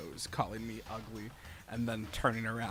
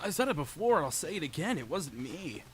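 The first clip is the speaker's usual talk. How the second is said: put-on anime girl voice; 6 semitones higher, 6 dB louder than usual. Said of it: "actual anime girl voice".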